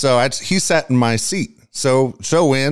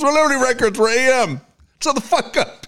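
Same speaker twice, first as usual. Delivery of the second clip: mildly mad producer; 10 semitones higher than usual. Retold or imitated. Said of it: "He goes".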